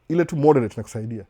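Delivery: ordinary delivery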